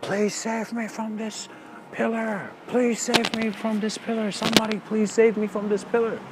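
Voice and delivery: high, desperate pleading cry; frantic shouting. Retold or imitated. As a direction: wail for rescue, clinging and crazed. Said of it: "he begins suddenly shouting".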